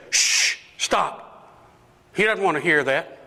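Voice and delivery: acted crowd telling someone off; shushing. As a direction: hush a nuisance, pitch climbing. Acted crowd hushing the blind man.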